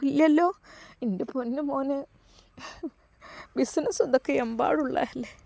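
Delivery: high, soft, crying mother's plea; tearful old mother. Acted. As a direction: beg him to come home, voice breaking. Mother begs son home.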